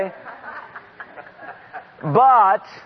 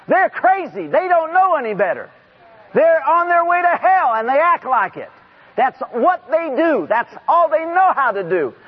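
high, impassioned pulpit rebuke; raised, high-pitched exhortation; forceful preaching; 6 semitones higher, 3 dB louder than usual. Real, not acted. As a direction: preach it hard to the congregation.

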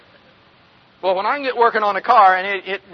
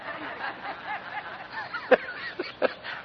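laugh; 11 dB quieter than usual. Real, not acted. Laugh after joke.

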